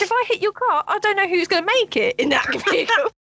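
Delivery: high, indignant rant at the other driver, a laugh breaking in; livid retort. Retold or imitated. Retelling a near crash, a high indignant rant. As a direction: imitate her livid outburst at the old lady. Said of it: "I was like".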